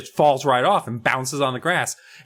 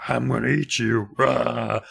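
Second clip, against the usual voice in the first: terrifying voice. Retold or imitated. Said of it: "this terrifying voice with it".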